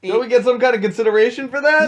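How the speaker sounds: put-on cheeky frat boy asking; frat bro. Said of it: "in his cutest frat boy voice ever".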